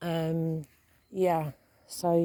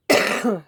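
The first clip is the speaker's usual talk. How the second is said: dry cough; 3 semitones higher, 5 dB louder than usual. Real, not acted. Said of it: "excuse me, I've got a tickle again".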